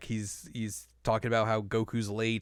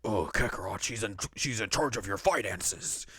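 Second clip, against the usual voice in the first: lower, softer mock Vegeta; Vegeta impression; 3 semitones lower, 5 dB quieter than usual. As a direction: sneer it as a lightly voiced Vegeta. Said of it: "I hope that's how he says it".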